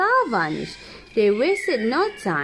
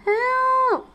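yelling for help; 13 semitones higher than usual. Acted. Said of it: "Alien keep yelling".

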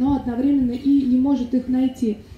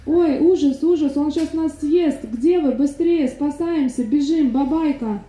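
raised, emphatic play-acted alarm; mock panicked calling; 5 semitones higher, slower than usual. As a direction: urge the boys to flee in pretend panic. Mother fakes panic, calling boys.

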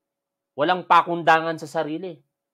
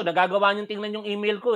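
normal talking voice

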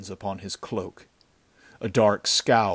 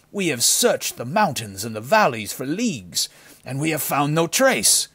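loud challenge, rising higher as it goes; heated arguing; 5 semitones higher, 7 dB louder than usual. A narrator's reading. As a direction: argue back with mounting agitation. Henchman argues heatedly with leader.

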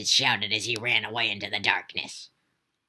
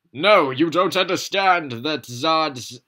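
loud, high, slow character protest; high pleading cry from Zod; Zod's loud voice; 7 semitones higher, 10 dB louder, slower than usual. Acted.

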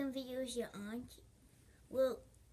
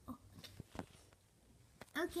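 ordinary talk

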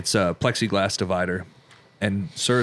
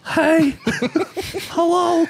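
mimicked voice; much slower than usual. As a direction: squeak out a hello in a mimicked voice. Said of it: "try to mimic the voice".

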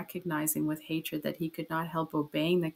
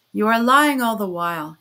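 loud accusing cry; 8 dB louder than usual. A narrator's reading. Raskolnikov accuses Porfiry of lying, crying out. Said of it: "he cried".

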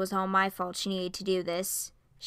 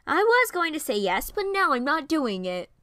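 higher, louder mock huff; indignant; 7 semitones higher, 5 dB louder than usual. A man snubbed by a friend's joke, huffy and loud. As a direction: play the wounded pride, loud. Offended reply to Cassandra's teasing.

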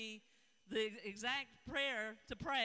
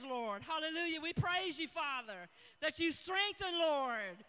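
loud, rhythmic prayer with Lord at each line end; fervent prayer; 2 semitones higher, 4 dB louder than usual. Acted. Pastor praying over the nation with listeners, fervent prayer. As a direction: pray it out with fervour.

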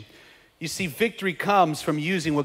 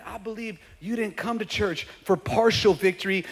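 ordinary talk, nothing marked

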